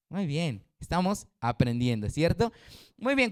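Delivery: ordinary delivery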